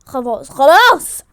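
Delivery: loud, slow, stern; loud "enough". Acted. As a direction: shut it down. Shouting to end it. (زعيق خلاص خلاص ببطء)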